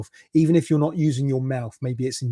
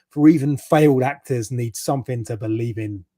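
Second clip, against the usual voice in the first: normal talking voice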